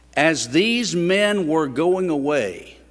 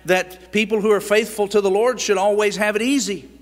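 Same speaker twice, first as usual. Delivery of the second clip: raised, insistent preacher's voice; fervent preaching; 7 semitones higher, 5 dB louder than usual. Acted. Preacher driving home a point to the congregation, fervent. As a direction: press each maybe home to the congregation.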